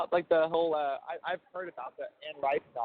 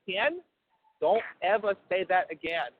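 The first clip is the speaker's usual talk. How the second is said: higher, drawn-out Cajun scolding; Cajun mom impersonation; 5 semitones higher, slower than usual. Retold or imitated. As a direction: play a Cajun mother telling someone off. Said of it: "that Cajun accent of your mom".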